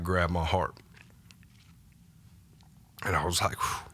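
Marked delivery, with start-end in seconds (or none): 3.6-3.9 a soft whispered whew, almost no voice; awed breathless exhale. Retold or imitated. Overwhelmed as God grabs his heart, whispered exhale. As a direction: let the breath out in quiet awe.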